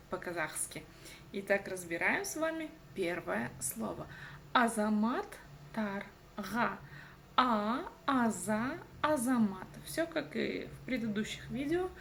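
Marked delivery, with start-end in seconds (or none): 4.5-6.8 "Азамат тарга" broken into slow separate syllables; teacher spelling out "Азамат тарга" in syllables. Shown as a demonstration. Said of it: "нужно разделить по слогам". 7.3-9.7 "А, аза, азамат" growing piece by piece with pauses; teacher's slow syllable drill on "азамат"; syllable build-up. Shown as a demonstration. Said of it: "нужно разделить по слогам".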